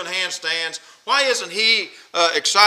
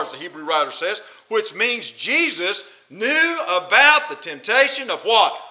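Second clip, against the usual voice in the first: ordinary delivery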